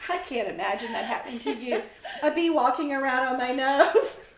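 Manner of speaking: giggling through the words; laughing. Real, not acted. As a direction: laugh while picturing it.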